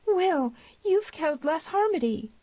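high, shrill cry of horror. A narrator's reading. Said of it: "the shrill cry of the girl".

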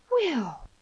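high, loud cry of a name; girl's cry. A narrator's reading. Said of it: "cried the girl".

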